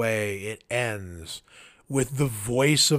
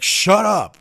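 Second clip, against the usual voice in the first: sharp angry shout, higher and louder; detective snapping at a needling visitor, shouting; retold roar; 6 semitones higher, 7 dB louder than usual. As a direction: bark it back at him. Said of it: "the detective turns and roars at him".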